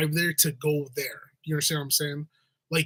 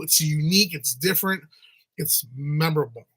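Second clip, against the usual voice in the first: ordinary delivery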